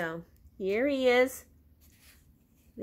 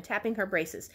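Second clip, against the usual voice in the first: ordinary delivery, nothing marked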